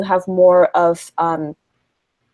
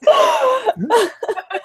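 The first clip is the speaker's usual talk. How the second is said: high-pitched burst of laughter; helpless laugh. Real, not acted. Guest losing it mid-introduction, laughing hard. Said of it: "I'm laughing so much".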